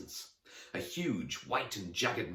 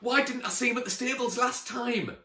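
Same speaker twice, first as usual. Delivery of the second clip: very high, louder squeaky question; amazed questioning; 6 dB louder than usual. Acted. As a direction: blurt it out, still astonished. Amazed at hidden unicorn.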